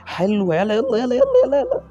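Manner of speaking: very high, loud urging; climbing to a squeal as the chance comes; frantic cheering. Real, not acted. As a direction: go go go, screaming. Cheering an attack on goal.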